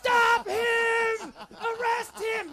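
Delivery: very high, loud, drawn-out shrieking; hysterical scream. Retold or imitated. Girlfriend snaps before the police, hysterical imitated scream. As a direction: imitate someone who has suddenly snapped. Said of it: "snaps. And what comes out of her mouth".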